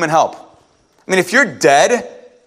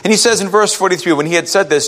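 ordinary talk

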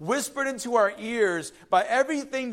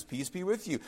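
gentle Jesus; 8 dB quieter than usual. Acted. Jesus greeting disciples, soft and gentle. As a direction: say it as a gift. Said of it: "He says".

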